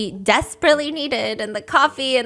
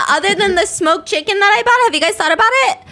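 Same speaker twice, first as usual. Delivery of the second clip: mock nagging mom; 9 semitones higher, 6 dB louder, faster than usual. Continues the mom-like lecture.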